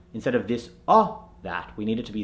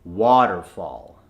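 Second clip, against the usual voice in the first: slow, lower waterfall with "ah" twice; American accent; 5 semitones lower, much slower than usual. Shown as a demonstration. Coach repeats the converted word, American accent. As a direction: say "waterfall" American, both vowels open. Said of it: "The A H is in water".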